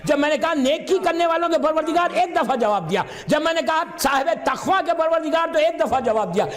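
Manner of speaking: high-pitched, fervent sermon delivery; rising majlis preaching; impassioned preaching. Acted. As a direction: drive the point home to the crowd. Preaching at full force.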